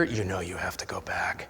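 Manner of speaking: whispered, close to the listener; hushed inner voice. Real, not acted. Preacher confiding to his congregation, whispered.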